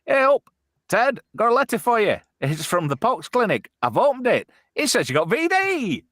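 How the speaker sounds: very high put-on cartoon postman voice; hammed-up, high comic dub; Postman Pat spoof. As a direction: deliver bad news as a high-pitched spoof postman. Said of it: "a badly dubbed video".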